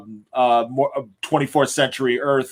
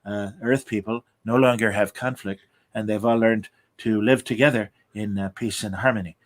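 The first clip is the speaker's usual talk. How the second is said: lower, softer, lightly voiced grandfatherly visionary; a hushed, dreamy take on a utopia pitch; Gene Roddenberry; 4 semitones lower, 4 dB quieter than usual. Podcast host doing Gene Roddenberry describing the future, low and soft. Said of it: "That's my attempt at a Gene Roddenberry future".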